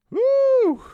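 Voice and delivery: very high, loud celebratory whoop; excited woo. Real, not acted. Caster whooping as the match ends, very high and loud. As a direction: let out a big cheer.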